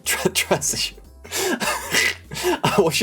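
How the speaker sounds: giggling through the word; laughing. Real, not acted. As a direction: breaks into high giggles.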